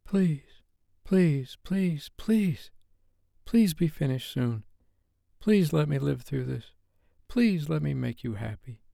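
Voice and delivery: high-pitched, tearful silent begging; desperate pleading. A narrator's reading. Woman silently begging for it to end, desperate. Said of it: "frustration and desperation in liquid form".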